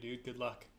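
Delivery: ordinary talk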